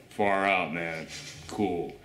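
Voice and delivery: a hint of a chill surfer dude. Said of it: "and it's like".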